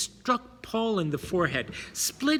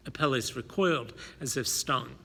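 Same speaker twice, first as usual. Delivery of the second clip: plain reading voice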